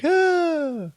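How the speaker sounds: high, drawn-out "ah"; mock overjoyed gasp. He sees she chose to stay, high mock gasp. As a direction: gasp high in acted happy surprise. Acts his reaction to her return.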